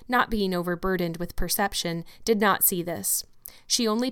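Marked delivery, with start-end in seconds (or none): none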